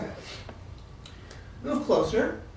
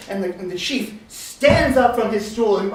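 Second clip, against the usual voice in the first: ordinary delivery, nothing marked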